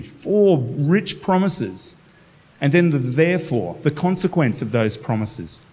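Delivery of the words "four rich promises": slow, weighty phrase; slow emphasis. Shown as a demonstration. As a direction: let each word land slowly. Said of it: "emphasizing the promises".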